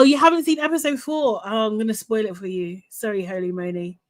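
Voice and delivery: ordinary talk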